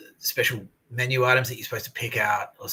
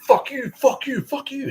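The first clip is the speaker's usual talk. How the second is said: very high, strained mock screaming; retold yelling; 6 dB louder, slower than usual. Prisoner lashing out at everyone around him, retold yelling. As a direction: re-enact a frantic yell at everyone. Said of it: "yelled at everyone".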